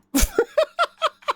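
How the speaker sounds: high incredulous laugh; burst of laughter. Real, not acted. Streamer told demos end soon, disbelieving laugh.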